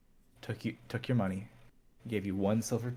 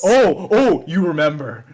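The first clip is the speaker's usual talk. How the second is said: louder, higher gasp of recognition; surprised realization; 7 semitones higher, 15 dB louder, slower than usual. Acted. Game character suddenly recalling the past, loud surprised voice. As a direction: catch your breath as it dawns.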